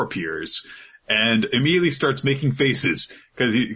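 ordinary delivery

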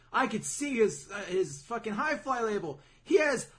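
high, loud, urgent explanation; excited Petta. Retold or imitated. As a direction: announce a discovery at full volume. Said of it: "that's when Petta's like".

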